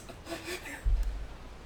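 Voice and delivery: airy unvoiced laugh, out of breath; breathless wheezing laugh. Real, not acted. Laugh after straining voice.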